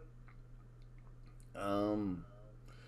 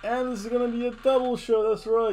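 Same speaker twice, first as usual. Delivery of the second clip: hyped announcer; 6 semitones higher, 4 dB louder than usual. Acted. Hyped double show announcement.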